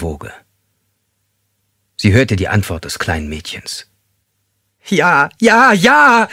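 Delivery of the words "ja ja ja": high, loud desperate yes; frantic cry. Acted. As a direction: cry out in panic, much higher and louder. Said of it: "rief sie". (laut und panisch hoch gerufen)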